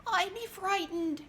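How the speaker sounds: a frightened squeak, pitched high; small scared voice up high. A narrator's reading. Charlie admits to the others he'd be afraid, high and scared. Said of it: "shivered Charlie".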